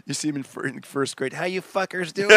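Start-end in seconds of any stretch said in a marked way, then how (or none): 1.3-2.4 louder, higher, brash showman; kid as rock host. Pretend. Comic imagines his first-grader hosting a talent show, brash and loud. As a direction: yell it like a cocky rock frontman.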